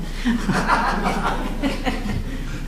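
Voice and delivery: quiet breathy chuckle; soft breathy laugh. Real, not acted. Amused at her own callousness, soft breathy laugh. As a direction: let out a hushed laugh.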